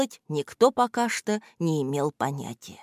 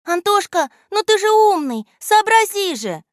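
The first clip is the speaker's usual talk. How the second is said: put-on high, louder girlish urging; 14 semitones higher, 6 dB louder than usual. A narrator's reading. Alya urges Anton to figure it out: high girlish urging. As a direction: push him to think, eager girl. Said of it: "понукала его Аля".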